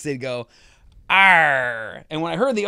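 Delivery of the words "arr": imitated pirate "Arr"; lightly voiced pirate growl-word. Host mimicking people's attempts at the bit, pirate voice. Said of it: "people would use the pirate voice".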